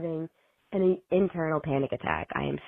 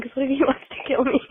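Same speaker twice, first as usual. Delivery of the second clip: laughing; 8 semitones higher than usual. Real, not acted. Joking about own fear, laughing.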